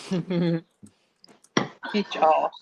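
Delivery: ordinary delivery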